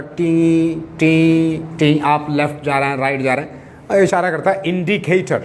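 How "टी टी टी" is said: slow clicking tick sounds, imitating a bike indicator; indicator beep. Teacher imitates the bike indicator for students, slow mechanical ticks. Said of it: "आवाज़ देता है".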